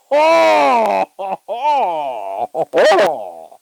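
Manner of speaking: big real burst of laughter; loud laugh. Real, not acted.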